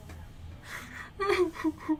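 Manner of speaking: high, breathless cackle that breaks into near-crying; laughing so hard it squeaks; wheezing laugh at the picture; helpless laugh. Real, not acted. Cracking up at a photo.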